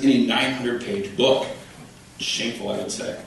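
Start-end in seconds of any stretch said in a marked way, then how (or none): none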